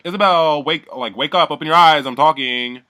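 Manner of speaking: loud, high scolding imitation; retold angry outburst. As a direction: act out her yelling at a dozing housemate. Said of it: "bucks on Isabel".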